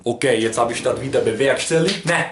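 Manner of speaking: imitated Cologne accent; Kölsch dialect. Shown as a demonstration. YouTuber frets about his camera, in Kölsch dialect. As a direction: put on broad Rhineland Kölsch. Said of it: "Ich kann noch Kölsch sprechen".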